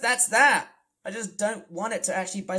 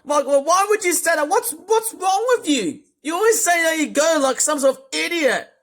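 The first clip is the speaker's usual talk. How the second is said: loud, much higher outraged yelling; exasperated shouting; 10 semitones higher, 12 dB louder than usual. Real, not acted. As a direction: scream at the character in disbelief. Shouting at Otis for swearing.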